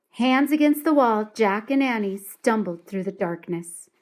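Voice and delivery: ordinary delivery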